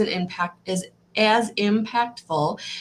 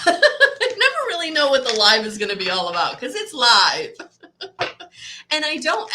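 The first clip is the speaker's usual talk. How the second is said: laughing; 7 semitones higher, 6 dB louder than usual. Real, not acted. Laughing at her own live stream.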